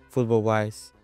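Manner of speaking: normal talking voice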